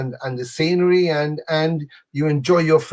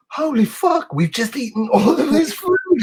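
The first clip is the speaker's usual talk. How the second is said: much higher mock-shocked exclamation; retold disbelief; 11 semitones higher than usual. Diner retelling more trays of food arriving, high retold disbelief. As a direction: exclaim high, replaying your overwhelmed reaction.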